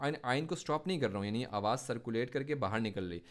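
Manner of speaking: normal talking voice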